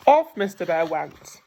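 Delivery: ordinary delivery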